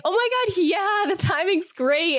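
hammed-up cheerful cover; mock forced enthusiasm. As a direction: act thrilled while cringing inside. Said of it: "Zeta had to be like".

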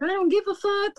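mock angry outburst. Retold diabetic customer snapping at the sweet employee, mock angry. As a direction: toss it off like a rude customer.

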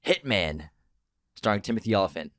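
normal talking voice

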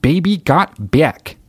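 slow, high folksy Alaskan twang; Sarah Palin accent. Retold or imitated. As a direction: stretch each word like Palin singing. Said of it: "She's got that fucking thick accent".

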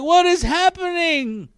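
high, loud comic bewilderment; baffled outcry. Retold or imitated. Preacher watching a stranger's tearful vlog, baffled. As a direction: cry out in mock disbelief.

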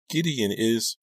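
put-on high, upset girl breaking off; starting to sob. A narrator's reading. Rachel telling her friend about Gideon, starting to sob. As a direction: pitch it high, as if tears are coming. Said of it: "she started to sob again".

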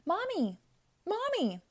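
high call of Mommy. A narrator's reading. Girl calling her mother from the kitchen, calling out. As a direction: call out across the house. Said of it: "I called".

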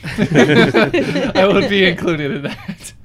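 words squeezed out through real laughter; laughing. Real, not acted. Player cracking up at the table's dog joke. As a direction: laugh helplessly while saying it.

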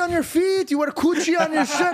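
high-pitched mock dad yell; imitating dad yelling. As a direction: push it up high, as an indignant dad. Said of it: "And you're yelling at me".

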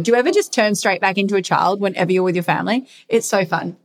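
ordinary talk

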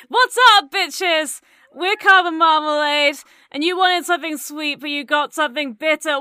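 high, very loud stage yell; full-force band intro, counting the song in; rock frontperson hyping crowd. Acted. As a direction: scream it out to a packed venue. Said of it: "she's gonna go".

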